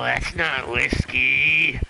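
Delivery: loud protest. Acted. Player protesting to the game, slow and loud. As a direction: drag out "whiskey" in loud disbelief.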